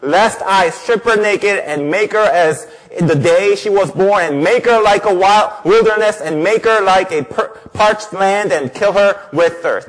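relentless, forceful divine rebuke; angry God. Acted. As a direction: read it as a furious jealous husband's threat. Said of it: "Why is God so angry".

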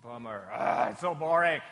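put-on groaning complaint; mock boredom. Children hearing they must join worship, mock bored groan. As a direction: groan it like a let-down kid. Voices children's reaction to church.

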